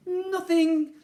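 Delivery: high, loud innocent denial; lying boy. A narrator's reading. Ned lying to his sister through the door, high and loud. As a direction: fib it brightly. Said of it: "lied Ned".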